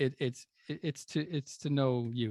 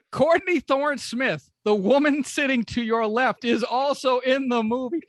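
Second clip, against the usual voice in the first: much higher, louder mock Conan warning; mock pleading Conan; 11 semitones higher, 5 dB louder than usual. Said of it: "Conan's like".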